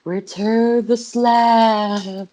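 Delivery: slow, drawn-out high ghostly call; eerie ghost. Retold or imitated. Ghost calling from the background, eerie drawn-out wail. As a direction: stretch it out like a haunting spirit. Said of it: "a ghost in the background saying".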